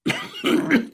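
put-on old man's high wheezy cough; acted coughing fit. A narrator's reading. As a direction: cough before trying to speak. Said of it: "vom Husten unterbrochene Stimme". (gespielter Hustenanfall eines Alten)

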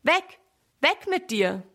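high-pitched shooing calls; calling out loud. A narrator's reading. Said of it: "ruft laut".

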